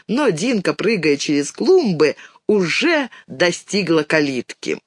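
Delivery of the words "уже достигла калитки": ordinary delivery, nothing marked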